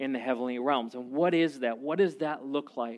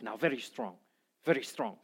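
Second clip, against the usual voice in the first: imitating Rex; 6 semitones lower, 9 dB quieter than usual. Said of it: "He goes".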